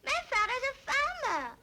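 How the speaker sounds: milkmaid of the rhyme. Acted. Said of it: "she said".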